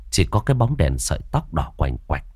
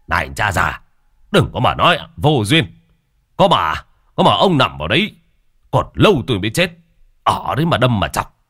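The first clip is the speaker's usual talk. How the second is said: louder, higher, irritated retort; snapping back; 3 semitones higher, 4 dB louder than usual. A narrator's reading. Coffin maker snaps at the old man mocking him, irritated. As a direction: snap at the teasing old man. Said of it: "Ông Chín quay lại gắt".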